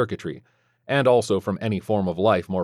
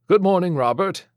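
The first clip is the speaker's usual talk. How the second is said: a lightly voiced kindly priest; lightly voiced friendly monk; padre voice; 10 semitones higher, 4 dB louder than usual. A narrator's reading. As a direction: greet him gently, a touch higher.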